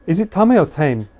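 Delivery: first "Tame" as TAH-meh, then "Tame" as taym; "Tame" said tah-meh versus "Tame" said as one syllable, taym; two pronunciations contrasted. Shown as a demonstration. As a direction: ask it: "Tame" Japanese-style, or "Tame" rhyming with lame. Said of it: "if words are pronounced Japanese way".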